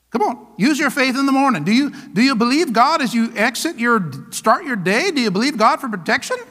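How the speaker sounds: normal talking voice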